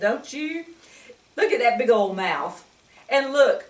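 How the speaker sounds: ordinary delivery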